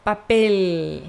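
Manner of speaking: "Papel" with an overdone tongue-tip L; self-confessed exaggerated teacher. Shown as a demonstration. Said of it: "eu sei que eu sou exagerada".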